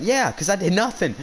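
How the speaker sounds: high-pitched retold defiance; defiant comeback. Student answering back to the teacher, defiant.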